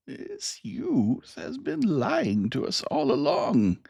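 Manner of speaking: put-on an old bearded man crying out in outrage. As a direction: accuse him in a cracked old voice. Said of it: "the old man with the beard cried".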